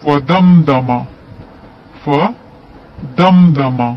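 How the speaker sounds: फदमदमा recited slowly with a clear, unnasalised म; slow recitation of फदमदमा; clear meem. Shown as a demonstration. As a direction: say फदमदमा, each म crisp with no ghunna. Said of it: "इजहार होगा".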